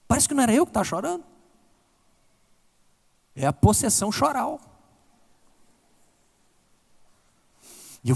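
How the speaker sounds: high, impassioned preaching voice, pushing each line up; preaching at full force. Acted. Pastor tells the congregation of his grave-side tears, impassioned preaching. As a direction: preach it like the tears are coming back.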